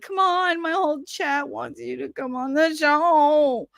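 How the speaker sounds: high, drawn-out whining plea; whiny voice. Pretend. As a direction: whine at mom to give in. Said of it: "I'll do the whiny voice".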